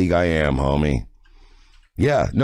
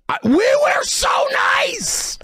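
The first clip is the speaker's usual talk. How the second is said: breathy shouted outburst, much higher; whispered shout. Real, not acted. Drunk streamer crying out that they were nice. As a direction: burst out in a strained whisper-yell.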